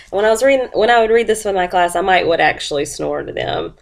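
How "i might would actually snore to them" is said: ordinary delivery, nothing marked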